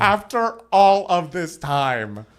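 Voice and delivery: high, slow, swooning; drawn out in awe; emotional gushing. Real, not acted. Gushing over [name] win.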